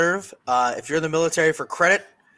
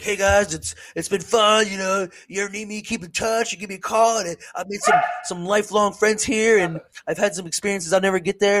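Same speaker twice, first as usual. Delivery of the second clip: lightly mocking sappy goodbye speech, a bit higher; mocking farewell; 5 semitones higher than usual. Imitating shipmates giving a sappy farewell, higher and mocking. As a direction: parody the sentimental crew member signing off. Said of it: "most people are like".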